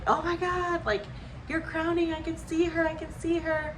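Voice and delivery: high, excited announcing; excited nurse. Retold or imitated. Nurse telling the mother the baby is crowning, excitedly.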